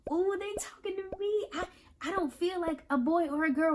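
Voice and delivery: a hint of a surprised kid's voice; lightly voiced child. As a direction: play the shy kid who's been noticed. Voices a book character.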